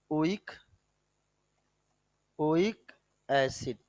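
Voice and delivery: ordinary talk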